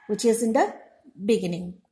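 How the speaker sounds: ordinary talk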